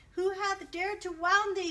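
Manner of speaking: loud, high enraged cry; the giant crying out over the child's wounds, loud and high; angry cry. Acted. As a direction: cry it out in fury. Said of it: "cried the giant".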